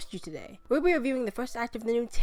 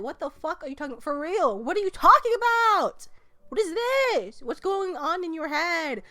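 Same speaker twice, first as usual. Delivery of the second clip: rising higher, louder baffled questions; stunned disbelief; 7 semitones higher, 4 dB louder than usual. Retold or imitated. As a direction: gape at the genius of it. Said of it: "I was like".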